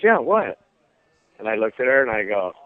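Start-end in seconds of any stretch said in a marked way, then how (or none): 0.0-0.6 mom's quick, higher-pitched response; imitated mom's reply. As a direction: answer as the mom, pitch jumping up.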